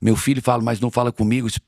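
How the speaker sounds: usual speaking voice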